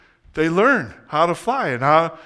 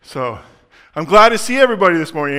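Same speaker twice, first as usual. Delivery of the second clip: ordinary delivery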